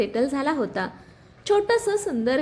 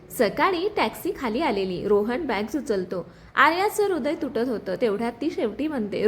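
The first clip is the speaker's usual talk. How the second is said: ordinary delivery, nothing marked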